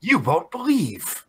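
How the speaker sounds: slow dramatic teaser; clickbait announcer. Pretend.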